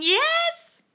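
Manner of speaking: very high, loud squealed cheer; piercingly high celebratory yell; excited cheer. Real, not acted. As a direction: squeal with delight at good news. Cheering a follower's manifesting news.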